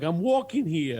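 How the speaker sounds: put-on New York accent. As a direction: shout it like a New York cabbie, hammed up. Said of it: "he's like".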